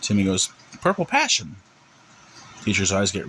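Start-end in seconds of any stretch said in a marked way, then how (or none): 0.8-1.6 lightly voiced little boy, high pitch falling at the end; boy's answer. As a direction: say "Purple passion" high, as a settled answer. Child answers teacher, falling.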